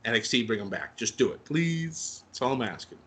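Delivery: ordinary talk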